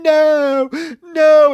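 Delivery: very high, loud, drawn-out mock scream; mock anguished wail. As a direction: howl a hammed-up refusal. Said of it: "she's laying there going".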